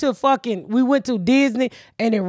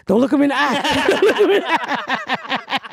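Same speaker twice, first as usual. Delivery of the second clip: laughing repeat. Retold or imitated. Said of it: "Cortland was like".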